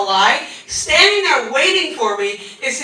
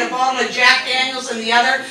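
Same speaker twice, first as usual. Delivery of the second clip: ordinary delivery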